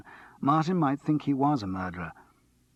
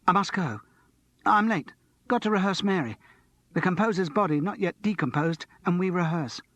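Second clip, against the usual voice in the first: put-on high, tense young man hurrying off; a nervy student rushing to rehearse; Martin, agitated; 7 semitones higher than usual. A narrator's reading. Restless Martin excusing himself to Charles, high and tense.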